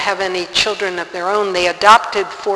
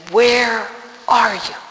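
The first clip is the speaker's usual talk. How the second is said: slow, drawn-out demand to God; retold cry at God; 3 semitones higher, slower than usual. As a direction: call out to God, stretching each word. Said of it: "I was screaming at God".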